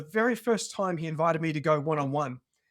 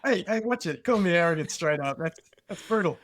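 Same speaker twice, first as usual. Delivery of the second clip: mock offended; 4 dB louder than usual. Guest jokingly protests being called arrogant.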